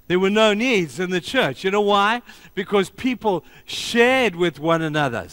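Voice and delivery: high-energy pulpit proclamation; impassioned preaching. Real, not acted. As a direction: preach it with rising fervour. Preacher builds to passion.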